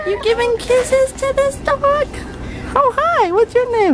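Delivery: high cooing puppy voice. Real, not acted. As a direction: coo at the dog delightedly. Cooing at the dog.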